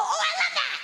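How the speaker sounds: very high, loud squeal of delight; piercingly high comic ecstasy; mock sex voice. Shown as a demonstration. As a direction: squeal it as hammed-up bedroom talk. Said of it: "this is my sex voice".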